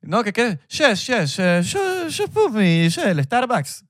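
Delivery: put-on high, heavily accented English; Israeli accent in English. Host imitates how Israelis sound speaking English, high accented voice. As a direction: imitate an Israeli ordering at Starbucks. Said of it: "se nota cuando hablan inglés".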